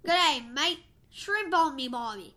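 lightly played Australian accent, half-joking. Kid doing an Australian voice for the podcast, light Australian accent. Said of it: "do your Australian voice".

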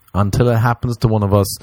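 ordinary delivery

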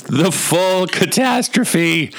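high, slow, drawn-out theatrical delivery; dramatic proclamation. Acted. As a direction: intone it like a grand tragic title. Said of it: "all I could think of was the words".

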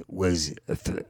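ordinary talk

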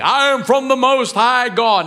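high, loud mock-angelic proclamation; proclaiming heavenly being. Glowing being announcing itself to pastor, grand proclamation.

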